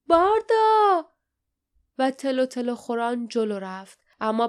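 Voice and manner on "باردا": loud, high, drawn-out cry of alarm; worried shout. A narrator's reading. Lief calls to Barda as the beast closes in, worried shout. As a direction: shout the name out in worry. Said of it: "لیف با نگرانی فریاد زد".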